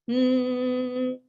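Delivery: lips closed steady humming; steady hum. Shown as a demonstration. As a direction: hum on one note. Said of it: "exhale to a humming sound".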